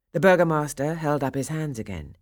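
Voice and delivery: usual speaking voice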